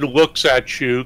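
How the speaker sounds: usual speaking voice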